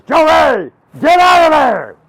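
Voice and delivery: high, very loud order; urgent scolding shout. Acted. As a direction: yell the child out of danger. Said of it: "She goes".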